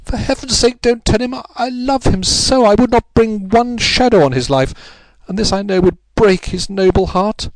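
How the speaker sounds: high, impassioned plea; frenzied supplication. A narrator's reading. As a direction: implore him desperately, voice held high. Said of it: "in a frenzy of supplication".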